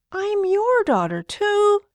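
put-on high, indignant little girl; child protesting. Little girl protesting to her father, high and indignant. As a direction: protest like a small child who feels left out, high. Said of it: "Liba protested".

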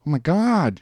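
high-pitched, restrained mock scream; high-register scream. A narrator's reading. Woman sees the check, understated high scream. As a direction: squeal it high without much volume. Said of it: "she screamed in a high register".

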